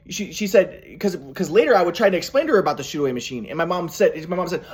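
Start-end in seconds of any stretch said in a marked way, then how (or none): none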